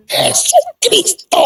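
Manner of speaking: high stretched proclamation; triumphant answer. Retold or imitated. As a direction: declare the choice with joyful finality. Choosing Christ over father.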